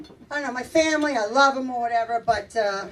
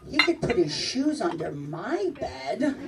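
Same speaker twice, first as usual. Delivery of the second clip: low, slow, lightly voiced grandma; grandmother's suggestive remark; 6 semitones lower, 4 dB quieter, slower than usual. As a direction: quote Grandma low and unhurried. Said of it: "she used to say stuff like".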